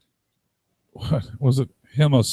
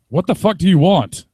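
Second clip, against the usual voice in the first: higher, louder mock challenge; mock confrontation; 6 semitones higher, 7 dB louder than usual. Stranger confronting someone out of place, mock angry. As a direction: throw out a hammed-up angry demand.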